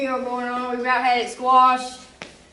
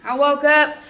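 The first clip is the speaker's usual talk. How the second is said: ordinary talk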